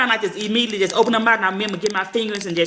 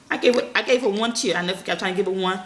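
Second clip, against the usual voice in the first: normal talking voice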